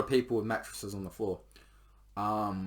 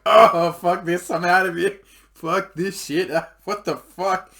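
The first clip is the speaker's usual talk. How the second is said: slow, loud, high freak-out; disgusted shout; 7 semitones higher, 13 dB louder, slower than usual. Retold or imitated. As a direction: bellow it out, repulsed. He freaks out at blood.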